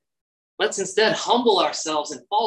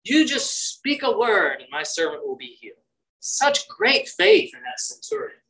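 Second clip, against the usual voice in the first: ordinary talk, nothing marked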